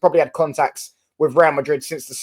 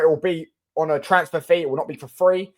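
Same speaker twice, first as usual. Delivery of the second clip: ordinary talk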